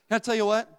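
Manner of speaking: ordinary delivery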